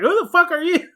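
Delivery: put-on a boy blurting it at a stranger; imitating a startled kid yelling; kid demanding. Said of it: "turns around and is like".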